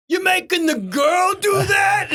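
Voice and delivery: slow, high heckling shout. Retold or imitated. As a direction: yell it across the lot.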